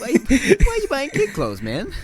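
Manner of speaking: high, loud, incredulous mock demand; loud mock question. Mocking the guy's odd purchase, loud and incredulous.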